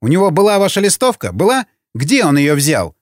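high, rapid, agitated questions; persistent. A narrator's reading. Informer arguing that the prisoner was planted, persistent. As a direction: keep pressing, pitch pushed right up. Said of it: "не унимался Ежов".

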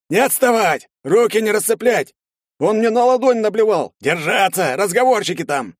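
high, loud shouted commands; окрики в строю. A narrator's reading. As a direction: bark down the line. Окрики в колонне на ходу. (громкие окрики в колонне)

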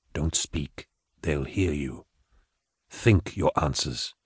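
low, soft telepathic calm; Gideon's psychic voice. Acted. Gideon reassuring Patience telepathically, low and soft. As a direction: speak gently into her mind, calming.

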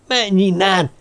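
high-pitched frantic yell. A narrator's reading. Terrified Jean-Luc drives away what he sees, yelling. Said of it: "ordította".

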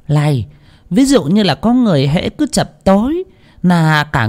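half-muttered ominous questions; muttering seer. A narrator's reading. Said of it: "lẩm bẩm trong miệng mà hỏi".

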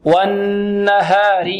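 والنهار with the n held long in the nose; slow, long nasal n in والنهار; held ghunnah. Shown as a demonstration. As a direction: hum on the doubled ن of والنهار. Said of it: "عندنا غنة".

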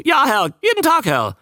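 very high, loud squawky creature; loud, high, quick croak; croaking newt. A narrator's reading. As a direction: introduce yourself as an eager talking newt. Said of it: "krächzte er".

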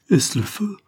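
high startled cry of fear; frightened maid asking about fire; terrified cry. A narrator's reading. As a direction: cry out in sudden terror from the window. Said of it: "dans un singulier excès d'une concevable terreur".

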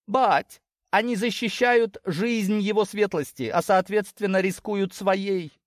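high-pitched voice making a heated case; indignant daughter. Acted. Daughter explaining her friends' blind loyalty, indignant. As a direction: argue the point, pitched well up.

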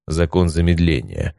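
ordinary delivery, nothing marked

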